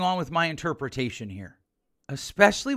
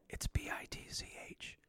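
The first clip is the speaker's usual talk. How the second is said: hushed, unvoiced-breath whisper, far softer; discreet whisper; 19 dB quieter than usual. Real, not acted. Professor's banned word spelled out for listeners, whispered. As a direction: whisper the letters like a forbidden word.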